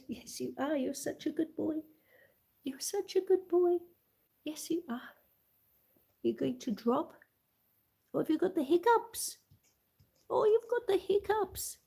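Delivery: high cooing praise; doting pet talk. Real, not acted. Owner cooing over her puppy's hiccups, high baby talk.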